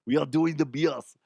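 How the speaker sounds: mock Austrian strongman accent; Arnold. German owner explaining the lost trophy, action-star impression. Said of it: "that's, that's Arnold".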